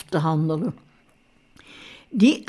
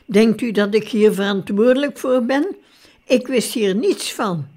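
ordinary delivery

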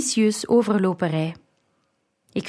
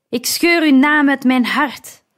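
raised, cutting rejection; cold; 5 semitones higher, 5 dB louder than usual. A narrator's reading. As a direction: pronounce it with cold hatred. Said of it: "zei hij koud". (koud en verheven van stem)

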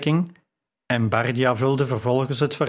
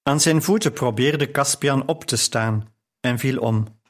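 ordinary delivery

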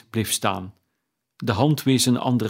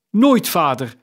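high, loud exclamation; calling out; 6 semitones higher, 6 dB louder than usual. A narrator's reading. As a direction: cry out in protest, high and loud. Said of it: "riepen ze allemaal weer". (hoog en luid uitgeroepen)

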